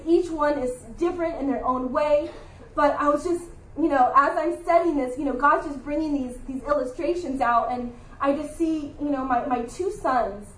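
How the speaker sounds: ordinary delivery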